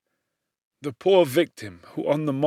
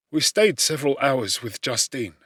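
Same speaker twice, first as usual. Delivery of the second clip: ordinary delivery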